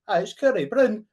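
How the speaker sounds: high, loud mock Edinburgh accent; Edinburgh impression, loud and high. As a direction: imitate a mate's Edinburgh accent, pitched right up. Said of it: "he had an Edinburgh accent".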